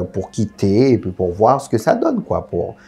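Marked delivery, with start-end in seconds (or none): none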